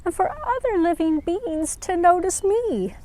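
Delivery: high, small voice of a sad little flower; a shy flower holding back tears; little flower, tearful. Acted. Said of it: "held back a tear and said".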